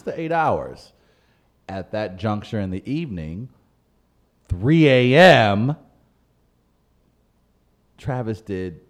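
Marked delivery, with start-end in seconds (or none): 4.5-5.8 THREE A M stretched slow and loud; dramatic emphasis. Real, not acted. Host confessing his club night hits THREE A M dramatically. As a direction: draw out "three A M", louder and higher.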